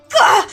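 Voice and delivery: high, loud strangled gasp; choked cry. Acted. Mion silenced mid-call, choked high cry. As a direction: choke as if something's in your throat. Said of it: "coughs like something stuck in her throat".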